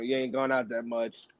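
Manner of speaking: normal talking voice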